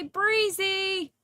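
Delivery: high, loud sing-song call of the name; excited greeting. Real, not acted. Greeting a viewer on her birthday, high and loud. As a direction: cheer the name out.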